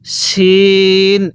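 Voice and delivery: শিন with a thick sh and long ee; drawn-out model শিন; thick SH sound. Shown as a demonstration. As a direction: hold শিন as a level "sheeen". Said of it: "এরকমভাবে শিখব".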